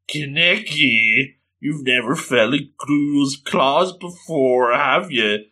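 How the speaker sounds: slow, drawn-out ghoul taunt. Acted. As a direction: toy with the prey, stretching each word. Ghoul leans over captured victim.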